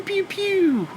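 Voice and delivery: high mock laser zaps; pew pew gun sounds. Mocking the wrestler's cheap sound effects, high zaps. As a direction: zap it like a toy blaster. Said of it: "they put little pew".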